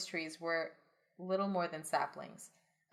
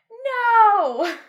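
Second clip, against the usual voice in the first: crowd shout; 17 dB louder than usual. Acted. Crowd answers the elder.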